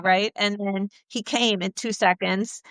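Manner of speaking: ordinary talk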